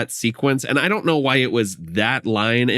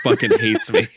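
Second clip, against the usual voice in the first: laughing quote. Retold or imitated. Quoting the character's complaint.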